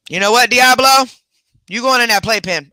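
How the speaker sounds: loud, high call to the dog; yelling at the dog. Real, not acted. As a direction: call the culprit out by name.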